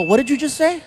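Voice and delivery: incredulous dad. Retold or imitated. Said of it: "I go".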